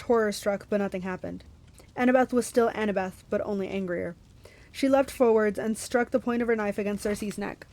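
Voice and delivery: ordinary delivery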